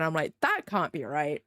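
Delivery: ordinary talk, nothing marked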